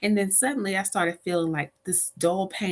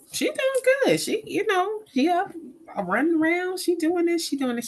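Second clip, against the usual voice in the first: much higher, light and carefree; breezy reassurance; 13 semitones higher than usual. Retold or imitated. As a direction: chirp it like nothing is wrong. Assuring friend mom is fine.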